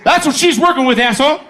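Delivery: high, loud mocking jab at the rival; loud taunt. Taunting the guy at the bar.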